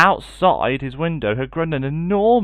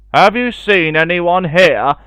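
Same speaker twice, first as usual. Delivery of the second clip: louder, slow, higher giant boom; 5 semitones higher, 9 dB louder than usual. Acted. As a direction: boom the question at the goose. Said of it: "boomed the giant".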